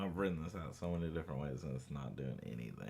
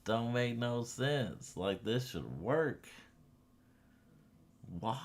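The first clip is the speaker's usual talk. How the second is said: exasperated; 7 semitones higher, 4 dB louder, slower than usual. Real, not acted. Code keeps failing.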